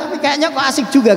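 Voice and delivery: high, quick and eager; wife's voice. Retold or imitated. Wife warming to the meal, high and quick. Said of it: "dia mengatakan".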